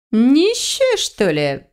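high incredulous jump, then dropping off; sceptical. A narrator's reading. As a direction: question it with scornful doubt. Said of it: "В тоне ящерицы проскользнули скептические нотки".

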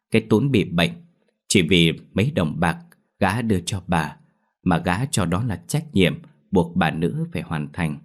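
ordinary delivery, nothing marked

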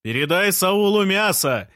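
high, carrying shout; calling from the street. A narrator's reading. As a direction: yell it up to a window from outside. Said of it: "крикнул с улицы Жан".

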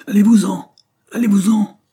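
father shouting his wife and daughter away; frantic shout. A narrator's reading. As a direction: drive them back with a desperate cry. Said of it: "cria monsieur Dare".

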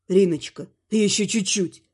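low, softened husband's voice; tender. A narrator's reading. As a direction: gently coax your wife to hold on. Husband tenderly encourages wife, then kisses.

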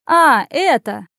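slow, high, loud realisation; drawn-out, rattled exclamation; flustered. A narrator's reading. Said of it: "Дама откровенно растерялась".

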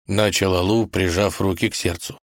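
usual speaking voice

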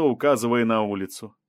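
ordinary delivery, nothing marked

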